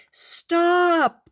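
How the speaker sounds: high, loud desperate plea; pleading. A narrator's reading. Ant begs the chattering fly to stop, high and loud. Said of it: "the ant pleaded".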